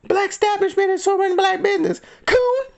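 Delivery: very high, squeaky mimicry; mocking a rant. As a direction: parody the ranting woman in a high voice. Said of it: "go on a racist rant".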